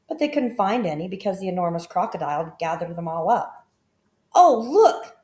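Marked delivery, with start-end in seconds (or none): 4.3-5.2 high, loud excited call; a child spotting something, eager; excited cry. A narrator's reading. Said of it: "cried Toto".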